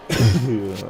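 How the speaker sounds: sharp cough after swallowing a bug. Real, not acted. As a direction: cough to clear the throat. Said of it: "The bug just flew in my mouth".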